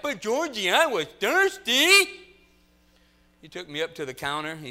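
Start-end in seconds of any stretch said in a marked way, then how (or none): 0.0-2.1 high, loud drawn-out mock whine; real loud. Pretend brother excusing himself to George, real loud. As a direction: protest high and loud, stretching the end. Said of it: "real loud".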